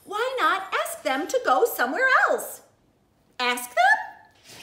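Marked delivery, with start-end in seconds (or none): 3.4-4.6 puzzled high. Acted. Elephant puzzled by idea.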